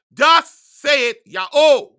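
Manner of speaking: slow, booming "Thus saith Yahweh"; thundering proclamation. Real, not acted. Preacher declaring God's word to his listeners, booming and slow. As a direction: proclaim it like a prophet from the pulpit.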